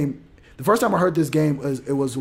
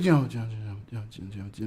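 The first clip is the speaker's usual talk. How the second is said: mumbled dodge; 6 semitones lower, 8 dB quieter, faster than usual. Retold or imitated. Evasive answer trailing off.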